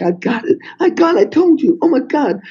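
high, strained mock despair; imitating his own frantic near-crying; retold near-tears panic. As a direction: replay the moment of certain doom, voice up high. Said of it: "I'm almost crying".

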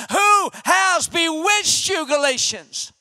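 high, slow, emphatic demand; intense challenge. Acted. Paul confronting the Galatians, intense. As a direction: punch out each word slowly. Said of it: "he says that with more intensity".

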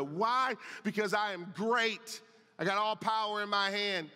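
swelling preacher's voice voicing God's promise; preaching at full force. Pastor voicing God's reassurance to the congregation, preaching at full force. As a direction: preach it, building with conviction.

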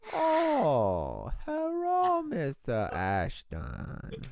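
put-on slow, high-pitched Chinese accent greeting. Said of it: "she has a Chinese accent".

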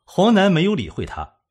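ordinary delivery

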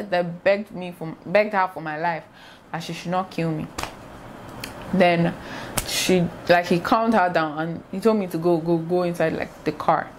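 ordinary talk, nothing marked

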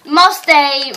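loud, high call for the horse. Real, not acted. Boy calls for his lost horse Musty, loud. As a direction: holler for the horse across the field.

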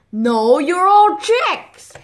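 high, loud, drawn-out scolding; exasperated insistence. Acted. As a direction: overrule the squabbling little ones loudly. Mommy quarrels over who is fox.

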